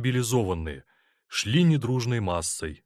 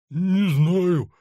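dopey drawn-out mumble, higher voice; mumbled; 9 semitones higher, 7 dB louder than usual. A narrator's reading. Orderly answering the colonel's sharp question, mumbling. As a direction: hem and haw, dragging out the sound. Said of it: "промямлил тот".

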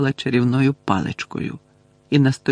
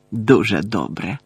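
plain reading voice

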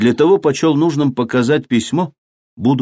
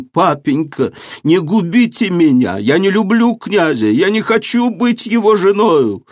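climbing higher, begging harder each line; desperate pleading; 8 semitones higher than usual. A narrator's reading. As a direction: daughter begs her father not to marry her off, rising plea. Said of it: "закричала она жалобным голосом".

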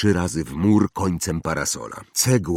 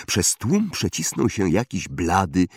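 ordinary delivery, nothing marked

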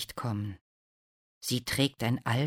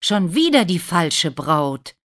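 louder, raised exasperation; exasperated exclamation; 4 semitones higher, 8 dB louder than usual. A narrator's reading. Prince sees he has the wrong bride, loudly exasperated. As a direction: cry out in frustration. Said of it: "ruft der Prinz".

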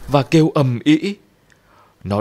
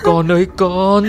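put-on higher, louder, crying cry for her child; frantic wail; 7 semitones higher, 5 dB louder than usual. A narrator's reading. The mother runs upstairs wailing for her daughter. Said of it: "vừa chạy lên tầng vừa gào".

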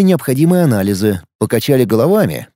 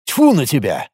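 cross. A narrator's reading. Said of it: "осерчал Сёма".

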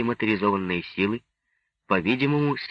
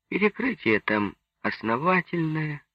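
higher, assured statement; convinced; 7 semitones higher than usual. A narrator's reading. Pugachev reassuring Nelli about the shelter roof, convinced. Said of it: "сказал Пугачёв убеждённо".

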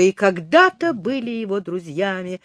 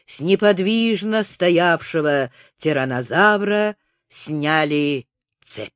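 usual speaking voice

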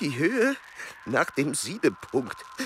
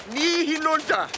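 hoch und scharf gerufen; eindringliche Warnung; 3 dB louder than usual. Acted. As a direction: ruf die Warnung hoch hinaus. Warnung auf dem schmalen Steg. (Warnruf an den Begleiter auf dem Steg, hoch und eindringlich)

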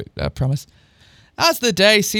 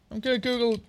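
ordinary delivery

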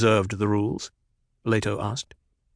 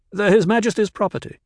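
curt, much higher and quick; curtly; 10 semitones higher, 4 dB louder, faster than usual. A narrator's reading. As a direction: bite it off, clipped and cold. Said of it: "Kynes spoke curtly".

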